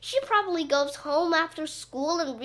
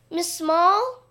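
higher, louder searching call; 7 semitones higher, 5 dB louder than usual. A narrator's reading. Teacher calling into the empty gym, calling out. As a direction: call across the gym for someone. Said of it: "called Miss Stacy".

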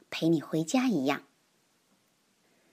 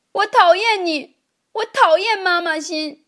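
put-on much higher, louder angry child's yell; loud shout; 10 semitones higher, 7 dB louder than usual. A narrator's reading. Boy shouting at the teacher in anger, high and loud. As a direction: scream it like a hurt boy lashing out. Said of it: "大声地对老师说".

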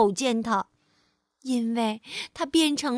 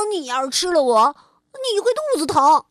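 loud, stressed on 变; chameleon warning the lion; bold bluff; 3 semitones higher, 6 dB louder than usual. Acted.

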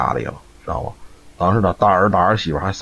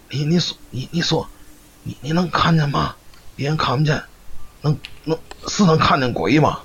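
high, stammering, frightened question; 8 semitones higher than usual. Retold or imitated. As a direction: stutter it out, scared of the answer. Grandma Li frightened by ghost talk. (结结巴巴、害怕地追问)

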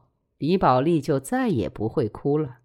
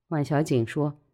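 plain reading voice

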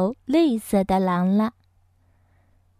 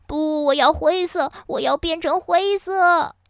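high, whining story-book wail; 7 semitones higher, 3 dB louder than usual. A narrator's reading. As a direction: wail the demand like a tearful child. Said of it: "哈瓦尔哭了起来". (高声哭腔，执意要求)

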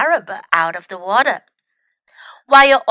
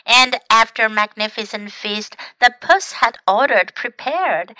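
usual speaking voice

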